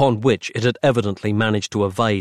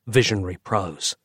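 usual speaking voice

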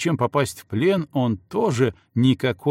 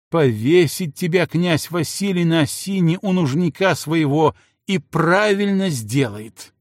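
яростная брань; 6 semitones higher, 3 dB louder than usual. Acted. Жена бранит мужа.

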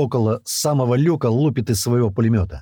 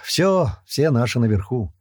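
usual speaking voice

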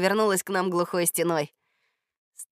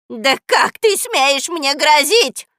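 higher and louder, slow outraged howl; screeching; 6 semitones higher, 9 dB louder, much slower than usual. Acted. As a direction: yell it in outrage. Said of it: "завопила Баба Яга".

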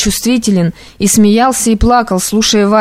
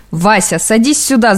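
usual speaking voice

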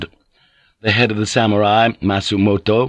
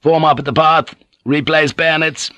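high, raised parade-ground order; military command; 7 semitones higher than usual. Acted. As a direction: call the order out to the ranks. Officer orders the troops.

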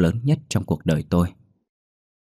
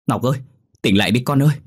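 raised, anxious mother's call; urgent pleading; 5 semitones higher, 4 dB louder, faster than usual. Acted. Mother tries to wake her dazed daughter, urgent pleading. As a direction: call out to wake your child, pitch up.

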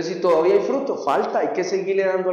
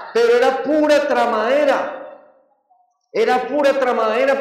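higher and louder, hammering pura; emphatic exclaiming; 6 semitones higher, 5 dB louder than usual. Real, not acted. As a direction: insist it was all bluff. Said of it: "repetición enfática". (exclamación enfática, más aguda y fuerte)

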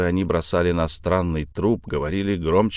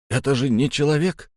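higher voice, rising to the end; insistent repeat; 7 semitones higher than usual. Acted. Cabin boy repeats himself to the uncomprehending first mate, insistent. Не понял меня мертвец.

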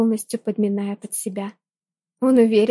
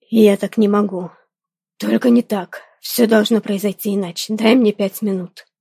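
a touch louder, abrupt male voice; impulsive male voice; 4 dB louder than usual. Acted. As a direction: blurt it out abruptly. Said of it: "порывисто проговорил он".

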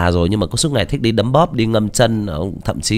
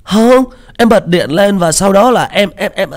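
much higher, louder, naive chubby guy; giọng Tiểu Mã; 12 semitones higher, 6 dB louder than usual. Shown as a demonstration. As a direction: sound like a cute, clueless fat kid. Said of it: "nó hơi ngây ngô một chút". (giọng cao, to, ngây ngô đáng yêu)

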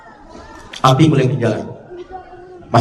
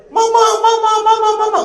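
put-on much higher, piercing call of a trapped child; child screaming. Child trapped in a burning building calling for mama, panicked.

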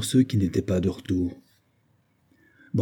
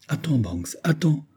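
reluctant plea; 6 semitones higher than usual. A narrator's reading. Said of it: "qui ne pouvait se décider à quitter".

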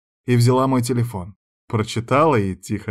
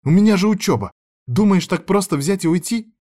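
high, loud indignant protest; lashing out; 9 semitones higher, 4 dB louder than usual. A narrator's reading. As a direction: object heatedly, pitched well up. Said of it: "накинулась на меня Аяна".

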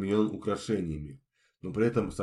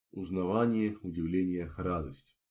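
plain reading voice

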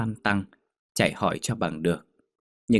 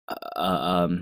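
halting, evasive hemming; stammering; slower than usual. A narrator's reading. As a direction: stumble for an excuse. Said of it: "bà lắp bắp".

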